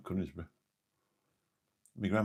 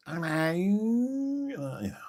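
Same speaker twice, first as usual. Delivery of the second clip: mock female Essex accent, very high, loud and drawn out; fake Essex accent; 13 semitones higher, 5 dB louder, much slower than usual. Streamer mocking the fake female Essex accent, high-pitched parody. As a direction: parody an Essex woman with a long high squeal. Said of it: "the fake female Essex adver-, uh, accent".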